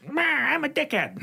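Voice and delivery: high, sneering put-on voice; mock the team owner with a high sneer; a snooty billionaire brushing off the pitch, high and sneering; dismissive owner voice. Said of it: "Mister Ricketts?".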